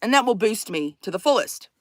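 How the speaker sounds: usual speaking voice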